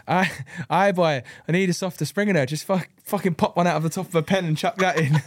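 badly faked accent of a racer; Alistair Seeley. Racer retelling a teammate's spring request, badly faked accent. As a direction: put on a bad imitation accent. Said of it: "that was a bad, real bad accident".

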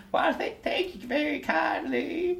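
put-on high, thin voice of a hunched old servant; old gentleman. Old servant thanking the player, high and meek. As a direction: play the bent old gentleman, humble and grateful. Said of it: "as he just kinda goes".

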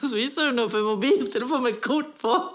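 ordinary talk

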